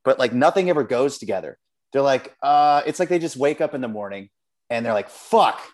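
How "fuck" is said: higher, louder acted cry of dismay; exasperated curse. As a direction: curse like you just remembered a forgotten chore. Acts out guide forgetting the groceries.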